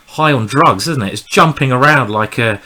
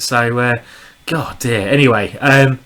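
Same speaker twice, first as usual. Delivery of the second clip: usual speaking voice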